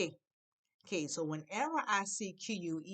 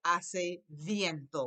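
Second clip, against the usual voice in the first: very slow "Hace viento", drawn out; slow model phrase; 5 dB louder, much slower than usual. Shown as a demonstration. Teacher models "it's windy" slowly. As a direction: stretch each syllable for learners. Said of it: "I say".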